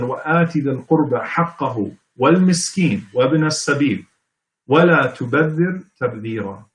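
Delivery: measured Arabic scripture recitation; Quran recitation. Acted. Lecturer quotes verses from Surah Al-Isra, reciting.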